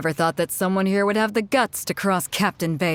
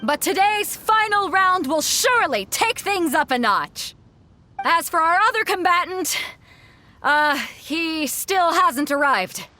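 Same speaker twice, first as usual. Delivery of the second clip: arena announcer; 10 semitones higher than usual. Acted. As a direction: hype up the crowd for the final. Keeps announcing to the crowd.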